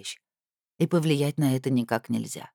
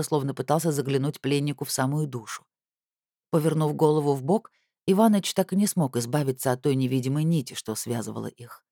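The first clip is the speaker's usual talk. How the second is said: usual speaking voice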